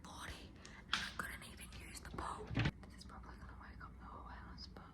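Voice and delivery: hushed late-night whisper. Real, not acted. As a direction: keep it to a whisper throughout. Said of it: "I have to whisper 'cause the whole family's asleep".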